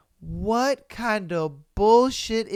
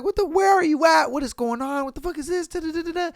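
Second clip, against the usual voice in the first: frantic mock call; 9 semitones higher, much faster than usual. Frantic wife calling husband.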